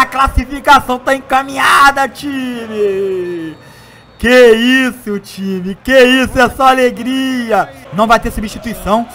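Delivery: lively football match commentary; play-by-play commentary. Acted. Live play-by-play of own match.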